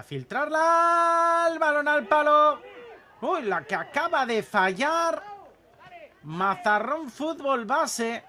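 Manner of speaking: loud, high shout at the near miss; rising roar as it hits the post; excited commentary. Real, not acted.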